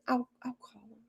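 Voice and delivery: repeating her line in a mock whisper. Mocking her promise in a hushed whisper. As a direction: whisper it like a sneaky aside.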